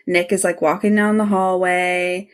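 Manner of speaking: ordinary talk, nothing marked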